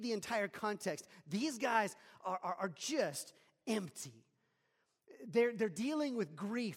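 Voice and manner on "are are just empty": ordinary talk, nothing marked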